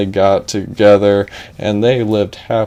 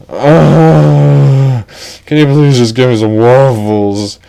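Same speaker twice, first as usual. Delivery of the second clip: loud, high talking door begging; a whiny door pleading for waffles; 4 semitones higher, 5 dB louder, slower than usual. Acted. The door begging Ed for waffles, loud whiny plea.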